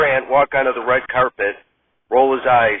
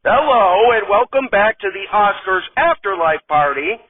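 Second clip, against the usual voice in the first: bright, higher TV host voice, big opening hello; red carpet host; 6 semitones higher than usual. Acted. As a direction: greet the audience like a cheesy awards show host. Host reads the intro a third time.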